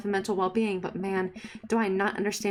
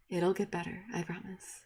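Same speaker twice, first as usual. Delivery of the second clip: usual speaking voice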